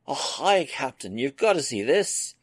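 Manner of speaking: high, loud, bursting with eagerness; Scotty can hardly hold his excitement in; an eager old engineer rushing onto the bridge; barely contained enthusiasm. Acted. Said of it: "with barely contained enthusiasm".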